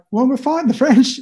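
put-on high, innocent little-boy voice; naive kid voice. Nine-year-old explaining to classmates, high innocent voice. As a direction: sound like a puzzled kid stating the obvious.

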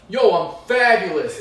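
loud swaggering Italian guy; a loud mock Italian tough guy; Italian Fred. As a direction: boom it out like a big man strolling in. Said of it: "an Italian Fred, walking in and be like".

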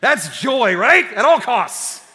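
high, loud mock triumph; hammed-up triumphant irony; ironic punchline. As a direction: sell the joke with fake pride. Ironic punchline after story.

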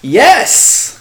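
sharp, high, loud yes; indignant shopkeeper yes. Acted. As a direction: snap back indignantly. Shopkeeper answers blame question.

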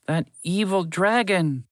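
slow, high mouse voice, seething; bitter mouse. Acted. Mouse cursing the dragon, slow and bitter. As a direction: spit out the hated name slowly.

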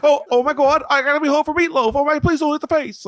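high squeaky frightened begging; cowering, pleading not to be hit; scared pleading voice. Retold or imitated. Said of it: "he's like".

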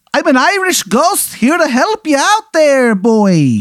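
high, hammy Irish brogue; overdone leprechaun ghost voice; bad Irish accent. Leprechaun ghost offering the boy help, bad Irish accent. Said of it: "His, his accent is terrible".

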